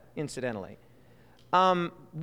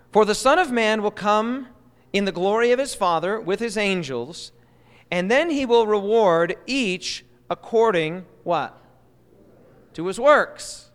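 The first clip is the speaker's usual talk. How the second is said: slow, louder reading of the verse; raised voice reading scripture; solemn scripture reading; 3 semitones higher, 4 dB louder than usual. Acted. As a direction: proclaim the words of Jesus. Reading Jesus' words aloud.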